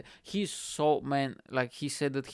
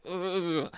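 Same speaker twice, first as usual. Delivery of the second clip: higher-pitched "ugh" of revulsion; disgusted groan; 5 semitones higher than usual. Retold or imitated. Reacting to a couple's awkward kiss, disgusted groan. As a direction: groan as if grossed out.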